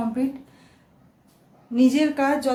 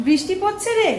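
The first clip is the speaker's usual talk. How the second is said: higher, loud call over the rain; 8 semitones higher, 5 dB louder than usual. Real, not acted. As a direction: shout it across to the family. Calling out in the rain. (বৃষ্টির মধ্যে জোরে ডেকে বলা)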